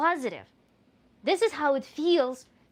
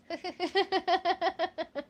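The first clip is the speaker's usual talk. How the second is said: amused laugh; 7 semitones higher than usual. Real, not acted. Reacting to accent comment.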